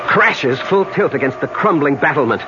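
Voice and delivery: narration climbing in pitch to a climax; dramatic story narration. Acted. Man narrates his plunge to death, mounting intensity. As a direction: build steadily towards the final words.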